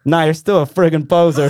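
mock insult laughed through; gruff old stagehand teasing the singer, laughing; laughing tease. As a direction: rib him while laughing. Big Earl teasing, laughing.

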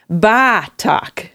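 put-on dropped-R Boston accent, "Ba Talk"; Texan doing a Boston accent. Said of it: "a Texan trying to do a Boston accent".

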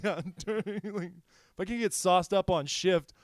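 put-on German accent, drunk cop whine. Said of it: "I love your German accent".

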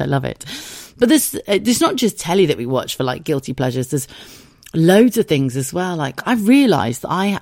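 usual speaking voice

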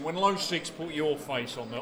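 normal talking voice

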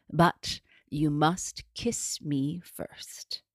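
slow, unvoiced hag whisper; gravelly whisper. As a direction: breathe it out like a crone. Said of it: "a low, gravelly voice".

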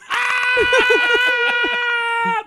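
high, loud terrified scream; retold scream. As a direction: let out a falling-off-a-bridge scream. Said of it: "you can hear me scream".

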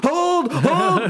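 high, drawn-out order; mock commander holding the line; hammed-up tense call; ship's captain. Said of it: "felt like we were on a ship".

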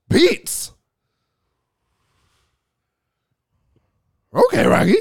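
very high, slow cartoon dog voice; Scooby-Doo mocking the snacks; Scooby-Doo impression. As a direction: stretch it out like Scooby. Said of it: "do your Scooby-Doo impression".

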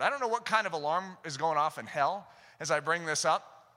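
usual speaking voice

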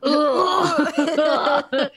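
loud disgusted groan with a laugh; disgusted ugh. Retold or imitated. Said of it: "He's like".

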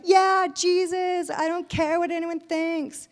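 high, loud and slow, showing off her boldness to Jesus; proud all-in worship. Retold or imitated. Worshipper at the altar telling Jesus, proud and loud. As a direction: shout it proudly like you're fully abandoned. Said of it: "I'm, like, proud of myself".

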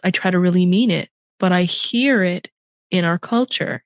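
normal talking voice

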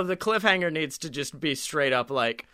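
normal talking voice

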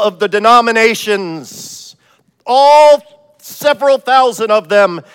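hammed-up loud heavenly decree. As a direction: proclaim it like God from the clouds. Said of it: "this loud voice from heaven".

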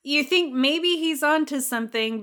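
ordinary talk, nothing marked

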